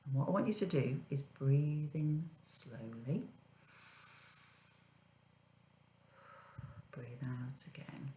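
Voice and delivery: hushed guided breathing; gentle whispered breathing cue; soft spa whisper. Acted.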